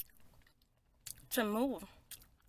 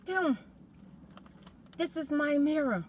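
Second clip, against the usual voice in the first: heated insistence; 5 semitones higher than usual. Retold or imitated. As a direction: claim the mirror back, raised voice. Quarrel with neighbour over mirror.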